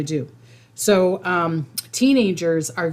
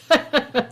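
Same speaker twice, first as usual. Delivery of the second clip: laugh; 9 semitones higher than usual. Real, not acted. Reacting to a gift.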